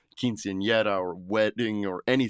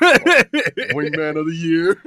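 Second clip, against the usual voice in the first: laughing loudly, slow and high; 7 semitones higher, 10 dB louder, much slower than usual. Real, not acted. Laughing loudly at his own grandma wingman story. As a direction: crack up while saying it.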